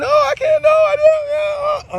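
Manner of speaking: high, drawn-out mock whimper; mock crying. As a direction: imitate her crying, squeaky and slow. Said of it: "she's crying".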